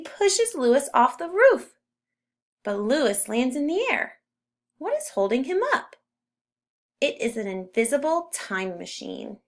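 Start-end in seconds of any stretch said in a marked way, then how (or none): 4.8-5.9 higher, quicker, puzzled question; storyteller building suspense; wondering. A narrator's reading. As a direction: ask it in wide-eyed wonder. Narrator wonders aloud.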